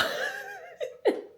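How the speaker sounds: squealing high laugh; high giggle. Real, not acted. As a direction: burst into a giggle. Laughs at potato.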